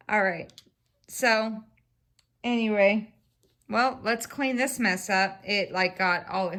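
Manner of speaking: usual speaking voice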